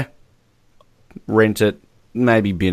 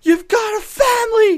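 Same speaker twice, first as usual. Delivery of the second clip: shouting. Acted. Acted battlefield rescue.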